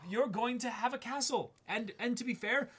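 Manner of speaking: normal talking voice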